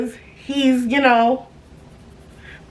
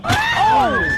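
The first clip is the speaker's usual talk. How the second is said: high shocked shriek; sudden high-pitched oh. Real, not acted. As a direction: squeal at your own burn. Reacting to her own roast.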